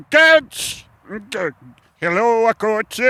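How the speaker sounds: high, loud calling voice; calling out alien. Acted. An Italian alien calls out to the coach. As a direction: shout for the coach like an odd stranger.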